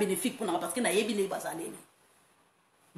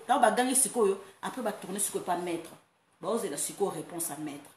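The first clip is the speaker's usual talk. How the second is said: ordinary talk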